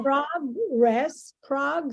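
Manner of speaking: PROG-ress, slowly broken up, front syllable stressed; stress on first syllable. Shown as a demonstration. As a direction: draw out progress, leaning on prog. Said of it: "accent would be in the first syllable".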